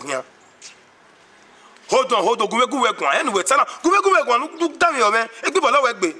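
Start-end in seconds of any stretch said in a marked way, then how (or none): 1.9-6.1 frantic shouting. Acted. Chaos, urging people to go.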